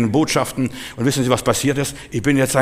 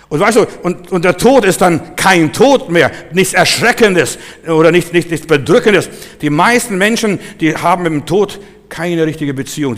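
loud, higher, punched key words; forceful preaching; 5 semitones higher, 6 dB louder than usual. Real, not acted. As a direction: proclaim it to the congregation. (mit Nachdruck gepredigt)

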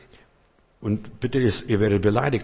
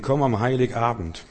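ordinary talk

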